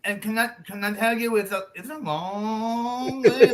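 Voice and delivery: hammed-up nervous stutter; timid harelip friend. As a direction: trip over the words apologetically. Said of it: "really timid".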